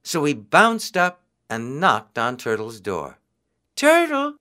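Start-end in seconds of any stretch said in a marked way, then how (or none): 3.8-4.4 high, loud eager call. A narrator's reading. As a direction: call out to him. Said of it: "he cried".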